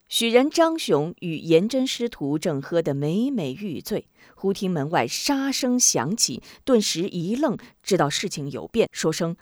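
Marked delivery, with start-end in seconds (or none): none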